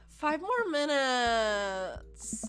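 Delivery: very slow sleepy mumble of a plea; groggy plea. Acted. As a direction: stretch it out like you're half asleep.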